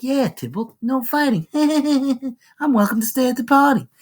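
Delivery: high, teasing mock gloat with giggling; gloating taunt. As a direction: rub it in with a high snicker. Romeo taunts the forbidden Tybalt.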